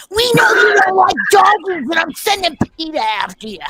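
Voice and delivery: mock angry shout. Host yelling at the thumbs-down voters, mock fury. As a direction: shout them down like a wronged pet owner.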